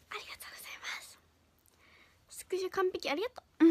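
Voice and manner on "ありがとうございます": fast breathy whisper of thanks. Acted. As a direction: whisper it quickly to the mic.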